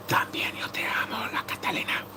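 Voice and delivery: whispered Spanish thanks. Real, not acted. Streamer whispering thanks to a viewer. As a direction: whisper it tenderly to a fan.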